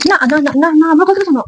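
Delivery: high, repeated pleading no; frantic protest. Real, not acted. Wife stopping husband to make him listen, frantic pleading.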